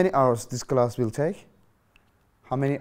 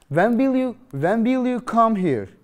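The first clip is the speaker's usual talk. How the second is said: raised question voice; 7 semitones higher, 5 dB louder than usual. Shown as a demonstration. Teacher acts the asker in a dialogue, higher and louder. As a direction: pitch it up like a real question.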